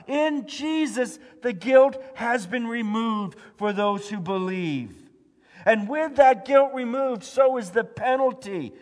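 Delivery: loud, high, urgent preaching; full-force preaching. Acted. Pastor urging the church that we cannot save ourselves, preaching loud. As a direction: drive it home to the congregation.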